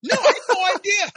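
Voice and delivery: high, loud, breathless laughing answer; giddy laughing reply. Retold or imitated. Fan telling the author she had no idea, high giddy laugh. Woman answering, still stunned.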